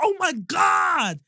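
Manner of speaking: very high, loud, drawn-out mock shout; imitated losing his mind. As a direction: wail it slowly in exaggerated amazement. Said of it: "Literally losing his mind".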